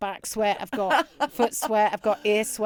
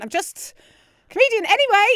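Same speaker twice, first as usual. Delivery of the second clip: nervous voice pitched much higher; higher and louder with stage nerves; 13 semitones higher, 10 dB louder, faster than usual. Retold or imitated. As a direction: squeak it out, rattled. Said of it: "my voice starts to get high-pitched".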